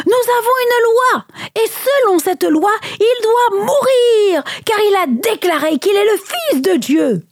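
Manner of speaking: high-pitched, insistent accusation; vehement retort. A narrator's reading. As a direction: argue back hotly, pitched high. Priests argue back before a crowd. (réplique véhémente, voix aiguë)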